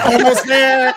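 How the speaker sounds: loud, high mock radio-tuner, half laughing; exaggerated straining towards the signal; tuning a radio. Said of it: "trying to find the frequency on a radio".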